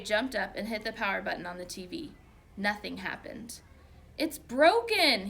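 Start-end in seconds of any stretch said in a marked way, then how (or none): 4.2-5.3 loud, high alarmed cry; exclaiming. A narrator's reading. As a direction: blurt out the bad news. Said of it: "he exclaimed".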